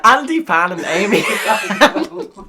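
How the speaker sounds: helplessly amused announcement; cracking up. Real, not acted. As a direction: blurt out the names through real laughter. Cracking up at rhyming names.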